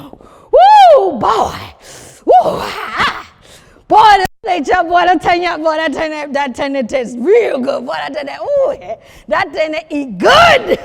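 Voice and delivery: whooping with delight over a plate; hollering praise for the food, mouth full of joy; loud excited eater. Acted. Guest at the table raving about the stew.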